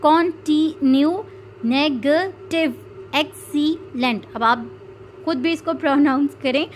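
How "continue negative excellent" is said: "continue, negative, excellent" slowly split into three beats each; three-syllable demo. Shown as a demonstration. Teacher showing three-syllable words, "continue, negative, excellent" slowly broken up. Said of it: "some have three syllables".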